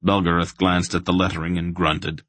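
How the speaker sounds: ordinary delivery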